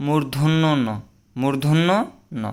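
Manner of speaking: মূর্ধন্য ন said twice, slow and clear; মূর্ধন্য ন, carefully; correct pronunciation. Shown as a demonstration. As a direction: model মূর্ধন্য ন for the class. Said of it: "সঠিক উচ্চারণ কী হবে?".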